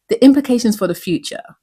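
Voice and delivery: ordinary talk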